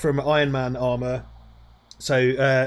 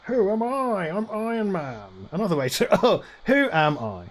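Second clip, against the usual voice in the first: Alan Partridge voice; 3 semitones higher than usual. Retold or imitated. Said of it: "Partridge Voice".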